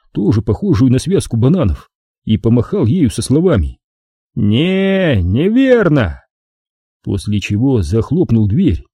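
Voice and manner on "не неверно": slow, blunt giant's refusal; a lumbering doorman says no; thick monster voice; dim brute. Acted.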